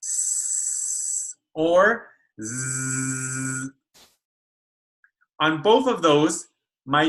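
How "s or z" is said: very slow, drawn-out "S or Z"; clear S and Z. Shown as a demonstration. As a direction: stretch each sound out slowly. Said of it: "to sound like this".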